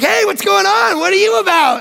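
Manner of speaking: high, bright phone hello; eager greeting. Retold or imitated. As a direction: greet the caller like a long-lost friend. Extrovert answering a sales call.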